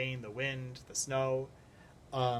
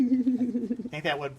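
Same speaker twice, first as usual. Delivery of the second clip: laughing; 9 semitones higher, 9 dB louder, much slower than usual. Real, not acted. Still laughing at the joke.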